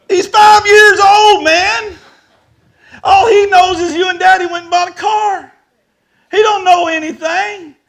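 very high, loud, wailing protest; exasperated shouting. Retold or imitated. Father defending his five-year-old son to the buyer, exasperated shouting. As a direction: plead the obvious at the top of the voice.

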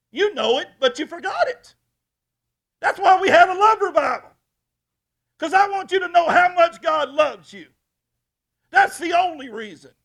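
loud, high sermon cry; preaching at full force. Acted. Pastor urging the church to remember, loud preaching. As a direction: lift it over the congregation.

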